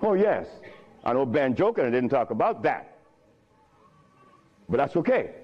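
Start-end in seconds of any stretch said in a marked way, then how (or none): none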